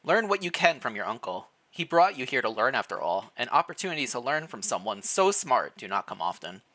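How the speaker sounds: usual speaking voice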